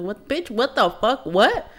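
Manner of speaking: affronted, escalating "what?"; incredulous retort. Retold or imitated. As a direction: retell it as stunned, indignant questions. Retold stunned reply to accusation.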